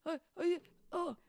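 high, loud excited pet-talk; voice for the dog. Shown as a demonstration. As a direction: egg on a dog with big high greetings. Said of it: "that voice that you do to him".

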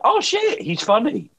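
high, startled "oh shit"; surprised audience. Retold or imitated. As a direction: mimic sudden realisation. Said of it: "they're like".

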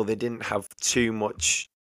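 ordinary talk

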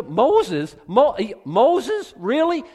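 loud, rising disbelief; mock-astonished questioning; incredulous. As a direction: scoff at the very idea, pitch leaping up. Mocking reliance on Moses over Jesus.

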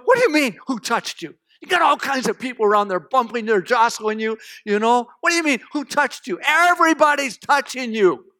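tired followers griping sarcastically at their leader; cranky sarcasm. Acted. Tired, cranky disciples answer Jesus in the crowd, sarcastic. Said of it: "almost hear the sarcasm coming out".

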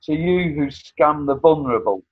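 accusing spoken-word delivery; poem recitation. Acted. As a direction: recite it with pointed scorn.

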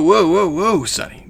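high, put-on halting call; imitated bum. Bum halting the teenager handing over the case, imitated bum. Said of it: "And he's like".

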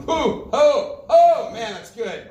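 loud, high whoops of rapture; mock Pentecostal shivers. Preacher acts out thrills at reading names, mock Pentecostal shivers. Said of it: "like, the Pentecostal shivers going".

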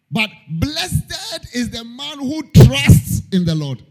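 slow, ringing preacher's proclamation; preaching at full force. Acted. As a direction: lift each phrase up to the congregation. Preaching the verse.

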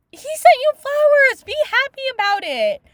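high, loud indignant outburst; exasperated scolding. Real, not acted. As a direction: scold loudly as if the character could hear.

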